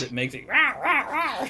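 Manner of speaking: playful rah rah roar; hammed-up shark growl; mock monster roar. Imitating rubber shark roar.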